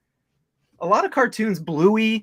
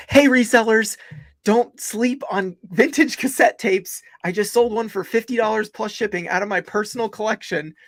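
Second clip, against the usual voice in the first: higher, louder hyped channel host; mock YouTuber intro; 8 semitones higher, 5 dB louder than usual. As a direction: sell it like an eager YouTube host. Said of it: "YouTube videos tomorrow's gonna be like".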